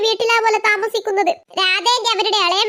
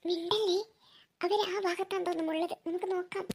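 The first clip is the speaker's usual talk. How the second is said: much softer, hushed voices; hushed sneaking; 9 dB quieter than usual. Acted. Sisters sneaking up to spy, speaking hushed. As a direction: keep it down so they won't hear.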